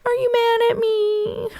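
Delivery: put-on tiny, very high-pitched tearful child; mock weepy little voice; imitated crying. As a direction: squeak it out like a scared, crying kid. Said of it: "I'm crying and I'm screaming".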